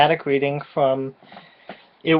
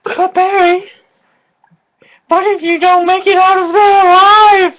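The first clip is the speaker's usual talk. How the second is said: high, far-off fretful damsel voice; hammed-up worried heroine, much higher; melodramatic heroine; 14 semitones higher, 4 dB louder than usual. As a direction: plead like a tragic soap-opera heroine. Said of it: "we're gonna do a dramatic reading".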